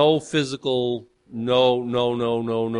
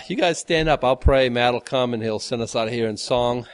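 normal talking voice

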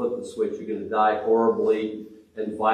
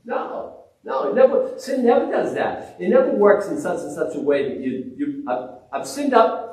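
high, protesting voice of a sinner; mock sinner voice; 7 semitones higher than usual. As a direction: play the stubborn sinner brushing it off.

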